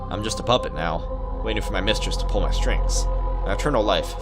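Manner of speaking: ordinary talk, nothing marked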